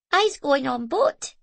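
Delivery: put-on a toddler chattering happily; little Scottish boy. A narrator's reading. Small boy telling Zoe about the boat.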